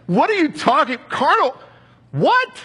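offended shock. Retold or imitated. Young believer to Martha, high shocked outrage. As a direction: squeak out stunned outrage. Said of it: "so offended, so shocked".

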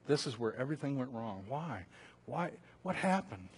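quiet, bewildered musing; hushed questioning. Acted. Preacher voicing a griever asking what went wrong, hushed. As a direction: trail down into soft, searching whys.

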